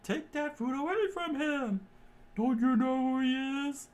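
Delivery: high, strained chef voice shouting a warning; alarmed chef. Acted. Chef warns Sanji about Krieg, high and shouting. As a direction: sound the alarm in a high put-on voice.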